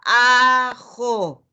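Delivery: "Ajo" said loud and joined up; blended word. Shown as a demonstration. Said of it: "Volvemos a la sílaba". ("Ajo" unido y fuerte)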